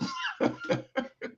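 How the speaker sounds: big laugh. Real, not acted. Joke about daughters.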